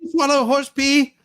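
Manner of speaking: high incredulous squeal of disbelief; can't believe what she's hearing; shocked disbelief. Real, not acted. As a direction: pitch jumps up in shock. Shocked reaction to horse urine.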